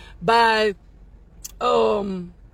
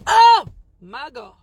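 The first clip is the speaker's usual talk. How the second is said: excited exclamation; 8 dB louder than usual. Real, not acted. Overcome by own words.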